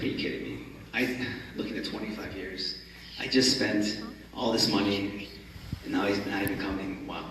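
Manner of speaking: whispered, crushed disbelief; barely voiced, as if to himself; hushed disbelief. Joe hears his lawyer missed the flight, whispering in disbelief.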